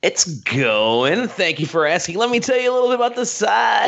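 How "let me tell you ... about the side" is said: smooth DJ announcer voice; lightly put-on radio host. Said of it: "you got a great radio voice".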